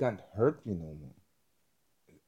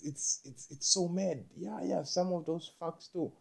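usual speaking voice

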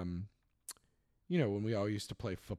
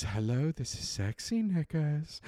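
put-on sultry femme fatale, slow and lilting up; hammed-up seductive phone voice, drawn out; Missus Slocum; 4 semitones higher, 4 dB louder than usual. Shop assistant answering her supposed admirer, put-on sultry femme fatale. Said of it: "sultrily femme fatale voice".

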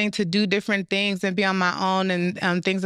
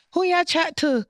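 imitating a stern Caribbean elder, pitched higher; Caribbean elder scolding; 9 semitones higher than usual. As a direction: play the affronted elder of a Caribbean home, in mock disbelief. Caribbean household elder scolding kids.

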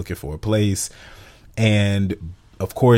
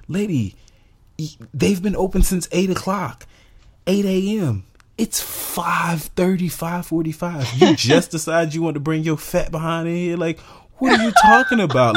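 mock telling off; 9 semitones higher than usual. Roasting the rude customer at the apartment office, sassy. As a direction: tell the lady off, incredulous. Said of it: "And I'm like".

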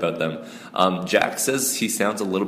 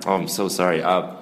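ordinary delivery

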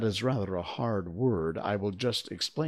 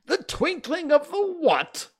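very high, loud outraged question; shrill, loud incredulity; incredulous king; 7 dB louder than usual. A narrator's reading. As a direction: cut in with royal disbelief. King interrupts in disbelief.

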